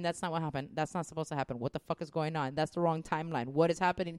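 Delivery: low, soft muttering; low, quiet mock grumbling at the screen; under my breath. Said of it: "under my breath going".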